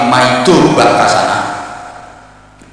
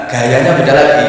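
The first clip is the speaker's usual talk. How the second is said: ordinary talk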